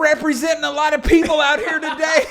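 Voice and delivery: high, loud cheering yell; cheering shout. Retold or imitated. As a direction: shout encouragement across the course. Spectator cheering him on.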